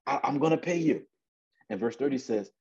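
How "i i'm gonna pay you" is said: high, stammered promise; begging. Acted. Debtor promising his creditor to pay, begging. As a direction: plead in a raised pitch. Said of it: "He's begging".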